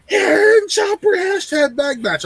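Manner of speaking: very high, drawn-out squealed readout; mock spoiler announcement. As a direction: squeal out the tweet in mock excitement. Mimicking a spoiler tweet.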